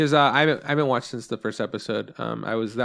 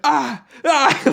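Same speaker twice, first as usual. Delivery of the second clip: high, loud startled cries; startled yelps; 14 semitones higher, 7 dB louder than usual. Retold or imitated. Nine-year-old nephew flinching at an anime scene, yelping. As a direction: yelp like you've been blinded. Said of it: "Shannon just goes".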